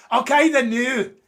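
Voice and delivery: put-on a clumsy Scottish accent for Debbie, drawn out and loud; bad Scottish accent. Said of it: "That's a terrible impression".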